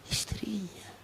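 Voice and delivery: whispered punchline; whispered aside to the audience. Real, not acted. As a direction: drop to a breathy whisper for the last word.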